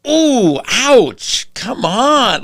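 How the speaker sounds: high, mock-hurt ooh and ouch; offended. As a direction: play the coworker acting wounded. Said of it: "he got super, like, offended".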